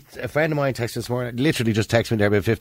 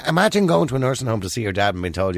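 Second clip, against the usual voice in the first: ordinary talk, nothing marked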